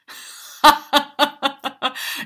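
loud, high burst of staccato laughter; hammed-up jeering ha-ha-ha; mocking laugh. A narrator's reading. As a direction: laugh out loud at someone's expense. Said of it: "se mirent à rire à leur tour".

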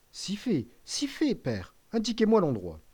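high, urgent protest; eager insistence. Acted. Son insists to his frail father, high and urgent. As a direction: push past his refusal, pitch jumping up.

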